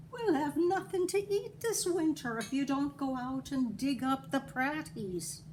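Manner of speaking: high nagging Irish wife. A narrator's reading. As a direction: scold your lazy husband. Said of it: "his wife Eileen would say".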